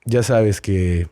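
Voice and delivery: formal, lightly theatrical apology in Spanish; mock apology in Spanish. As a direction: address Hilaria like a heartfelt public apology. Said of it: "fun watching you talk Mexican".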